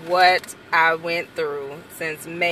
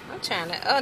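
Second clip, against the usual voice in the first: ordinary talk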